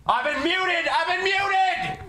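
high, slowed mock protest; mock outrage. Drunk host protests being muted, mock outraged whine. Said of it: "T J is being suppressed".

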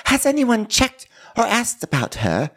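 high put-on housewife voice; exaggerated high impression; Dorit. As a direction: raise the pitch for an affected query. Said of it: "And Dorit's like".

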